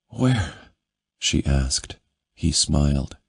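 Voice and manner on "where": higher questioning woman's voice. A narrator's reading. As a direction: ask it high and shy.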